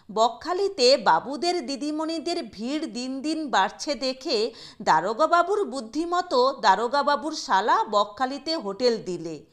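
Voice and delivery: ordinary delivery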